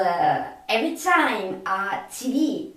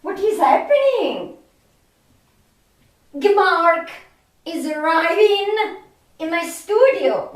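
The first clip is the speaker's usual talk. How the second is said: higher-pitched playful fright; mock alarm; 7 semitones higher, 3 dB louder than usual. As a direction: act startled as if a monster were coming. Pretends movie monster is arriving.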